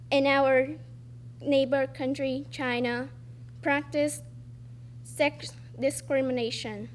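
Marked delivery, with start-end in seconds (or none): none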